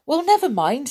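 perky storybook character voice; cheering up. A narrator's reading. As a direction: brush off the setback cheerily. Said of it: "cheering up".

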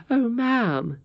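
high-pitched, dismayed outburst; shocked exclamation. A narrator's reading. Curdie is hurt she would ask such a thing, shocked exclamation. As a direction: protest in shock, voice leaping up. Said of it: "exclaimed Curdie with a shock".

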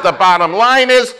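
high, loud, impassioned shout; acted righteous offense. Preacher quoting his offended pastor's bottom line, indignant. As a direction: declare it at full force. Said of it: "I had never heard anybody talk like that".

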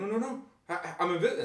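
high retold panic; mock freaking out. Retelling the prank victim left alone, mock high panic. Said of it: "he's freaking out".

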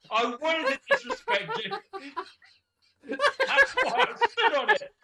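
very high, cracking with laughter; laughing. Retold or imitated. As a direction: barely get it out for laughing. Captor voiced, laughing.

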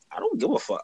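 high, blunt brush-off; blunt defiance. Retold or imitated. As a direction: throw it at him high and flat-out. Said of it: "I told him straight to his face".

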